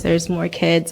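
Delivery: normal talking voice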